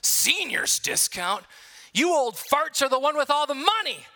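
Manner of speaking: mocking, scoffing golf pro; snide scoff at an old customer; snarky. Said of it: "kinda in a snarky voice says".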